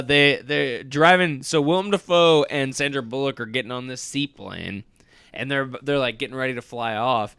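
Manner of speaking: normal talking voice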